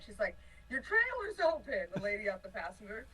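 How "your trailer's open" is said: high, drawn-out mock call; stretched-out warning call, retold; imitated calling out. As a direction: imitate calling over to another car. Woman calling from passing car.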